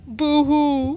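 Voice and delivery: high mock boo-hoo sob; loud hammed-up pretend crying; fake crying. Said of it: "Why are you sulking?".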